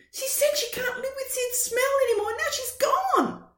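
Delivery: put-on high, rushed worried boy; upset child. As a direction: tumble it out like a worried kid. Boy tells Dad.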